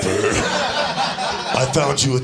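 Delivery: put-on a creepy guy lording it over the girl; creepy sober guy. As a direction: say it like a smug, sinister confession. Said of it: "you're like".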